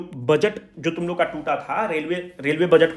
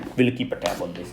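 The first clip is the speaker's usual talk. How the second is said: slow and softer, drawn out; slow dictation; 9 semitones lower, 8 dB quieter, much slower than usual. Shown as a demonstration. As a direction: dictate the closing line. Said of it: "इसको चिपका देना".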